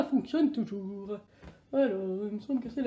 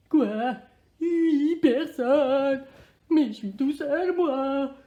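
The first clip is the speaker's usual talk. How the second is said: mock dismay; 3 semitones higher, 6 dB louder, slower than usual. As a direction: protest like a stunned child, hammed-up. Recipe turns out for eight.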